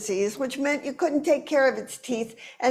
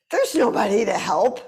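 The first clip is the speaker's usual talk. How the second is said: ordinary talk, nothing marked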